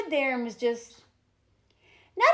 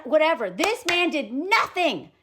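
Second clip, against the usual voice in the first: ordinary talk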